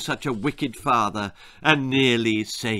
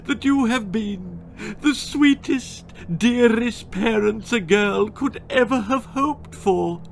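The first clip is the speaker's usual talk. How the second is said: sobbing farewell; 9 semitones higher than usual. Acted. Daughter thanking her parents, high tearful sob. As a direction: play a brave deathbed speech. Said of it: "Gwendolen sobbed".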